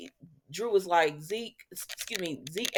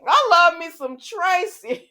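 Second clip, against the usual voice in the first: higher, louder, slow delighted laugh-talk; amused delight; 10 semitones higher, 6 dB louder, slower than usual. Real, not acted. Viewer delighting in Tracy, laughing. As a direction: savour it through amusement.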